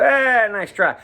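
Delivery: playful mock put-down; mock dismissive critic. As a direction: lightly imitate an unimpressed expert. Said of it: "any dyed-in-the-wool country guitar player would hear what I just did and go".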